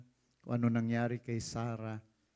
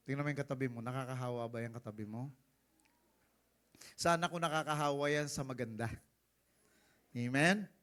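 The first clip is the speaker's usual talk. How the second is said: normal talking voice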